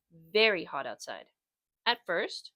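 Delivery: plain reading voice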